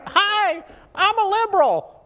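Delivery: high, silly puppet voice; liberal puppet voice. Pretend. To the camera, a monkey puppet playing a liberal, high and silly. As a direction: squeak it like a hand puppet. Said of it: "I got the liberals right here".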